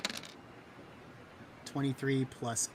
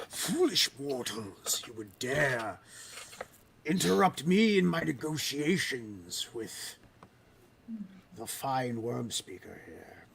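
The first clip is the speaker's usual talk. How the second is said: slow, cold, menacing dragon voice; cold rasping evil emperor; 3 semitones higher, 7 dB louder than usual. Acted. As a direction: sneer down at the foolish mortals. DM voices dragon threatening party.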